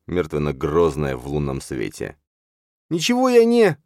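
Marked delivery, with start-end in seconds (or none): 2.9-3.8 loud, very high-pitched cry; desperate gasped protest. A narrator's reading. Числав protesting as father's fingers close on his throat, desperate. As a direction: blurt it out, cut off abruptly. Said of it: "выдохнул".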